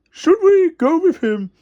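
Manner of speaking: a hint of a timid crane; Lofty asking. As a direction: ask nervously as Lofty. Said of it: "asked Lofty".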